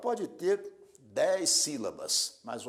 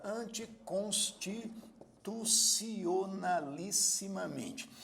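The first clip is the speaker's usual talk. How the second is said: "anticonstitucionalissimamente" slowly sounded out in separate chunks; syllable by syllable; 4 semitones higher, much slower than usual. Shown as a demonstration. Teacher introducing one of the longest Portuguese words, slowly syllable by syllable. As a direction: spell out "anticonstitucionalissimamente" by ear, slowly.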